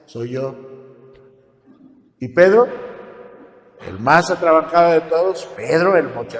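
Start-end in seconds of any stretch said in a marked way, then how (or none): 0.1-0.5 softer, calm and soothing; hushed voice of calm reassurance. Retold or imitated. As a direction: settle the frightened disciples gently. Jesus calming the frightened disciples.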